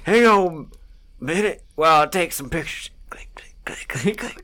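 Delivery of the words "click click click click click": whispered camera clicks. Acted. Mimicking a camera while taking pictures.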